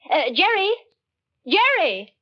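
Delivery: high, loud call across the garden. Acted. Wife calling brother-in-law from the window, loud and high.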